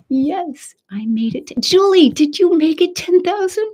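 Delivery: high, loud eager question; real thrill bursting out; excited. Real, not acted. Host cheering a viewer's ten thousand, excited. Asking viewer about milestone.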